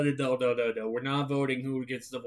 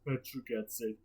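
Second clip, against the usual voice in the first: ordinary delivery, nothing marked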